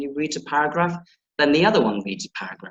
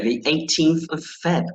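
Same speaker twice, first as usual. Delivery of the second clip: normal talking voice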